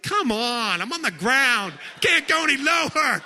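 hammed-up shout of complaint to his wife; mock exasperated protest. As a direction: protest like you're already flat on the floor. Mock protest at wife's advice.

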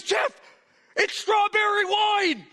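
high, drawn-out mock exclamation; hammed-up high-pitched excitement; excited wife. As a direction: imitate a wife crying out a revelation. Retells wife's excited revelation.

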